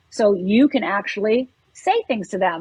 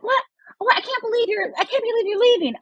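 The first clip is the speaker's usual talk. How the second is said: high, outraged mock protest; pitched-up hammed disbelief; mock indignant friend; 12 semitones higher than usual. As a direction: play the friend shocked that you're leaving. Friend protests you leaving.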